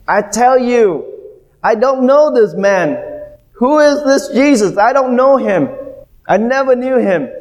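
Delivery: high, frantic denial of knowing him; panicked denial. Retold or imitated. Peter alone by the fire denying Jesus, high frantic protest. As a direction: protest too much, voice pitched high. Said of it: "what did he do?".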